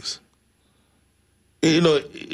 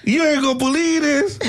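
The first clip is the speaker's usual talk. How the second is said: imitating a hysterical caller, very high pitch; hammed-up New York hysterics, voice way up; Miss Annie, hysterical. Caller phoning late with unbelievable news, mock hysterical. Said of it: "but she's hysterical".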